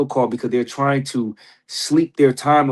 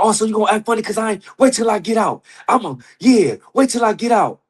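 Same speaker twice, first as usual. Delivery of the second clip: mock jailhouse threat; 8 semitones higher than usual. Inmate threatening his girlfriend.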